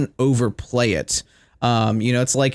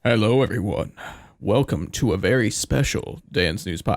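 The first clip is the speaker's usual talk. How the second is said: a lightly lowered put-on host voice, half-serious; put-on announcer voice; 2 semitones lower than usual. Host greeting listeners in a mock announcer voice. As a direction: slip into a mock announcer read, only slightly. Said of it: "I don't know why I'm doing that voice".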